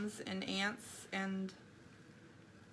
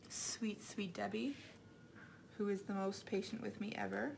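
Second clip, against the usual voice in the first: soft fond aside; 8 dB quieter than usual. Real, not acted.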